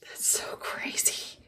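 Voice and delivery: breathy whisper, slow; whispered amazement. Real, not acted. Whispering amazement about the daycare chickens, slowly. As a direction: hush it in disbelief.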